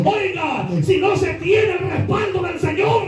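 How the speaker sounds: high, slow shouted preaching; preaching at full force. Acted. Preacher rebuking well-dressed churchgoers without God's power, shouting sermon. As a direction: thunder it from the pulpit.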